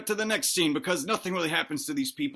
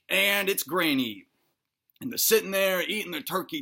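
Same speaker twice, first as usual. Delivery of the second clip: plain reading voice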